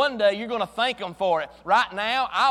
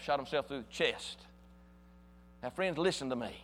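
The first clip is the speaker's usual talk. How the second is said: ordinary talk, nothing marked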